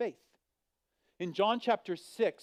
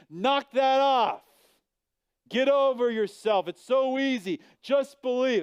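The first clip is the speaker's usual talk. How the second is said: louder and higher, slow and scolding; harsh dismissal; 8 semitones higher, 8 dB louder than usual. Acted. Preacher mocking a harsh reply to a doubter, loud scolding. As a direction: bark it at a struggling doubter.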